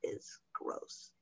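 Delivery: whispered aside; very soft, whispered confession; hushed aside. Real, not acted. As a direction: drop to a whisper, as if confiding. Confiding dislike of the public.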